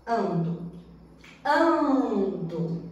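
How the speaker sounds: "ando" with the first syllable AN stressed. Shown as a demonstration. Teacher showing stressed root syllable, stress on AN. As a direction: lean on the AN of "ando". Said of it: "sílaba tônica dentro do radical".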